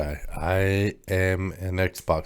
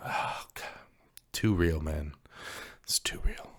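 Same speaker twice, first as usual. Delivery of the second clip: pained whisper; 3 semitones lower, 9 dB quieter than usual. Real, not acted. Mock-wounded after roast.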